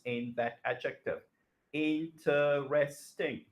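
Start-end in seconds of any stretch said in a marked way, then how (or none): 1.7-3.4 slow syllables. Shown as a demonstration. Teacher spells out Interesting syllable by syllable, slow. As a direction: stretch out Interesting slowly so each syllable is heard. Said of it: "It's about four syllables".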